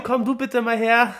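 high, slow coaxing call; kitten voice. Acted. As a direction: coax the kitty closer. (lockende Kätzchenstimme)

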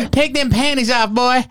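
Deliverance hillbilly impression. Joking about Deliverance, hammed-up hillbilly threat in a high voice. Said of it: "I've been goddamn Deliverance".

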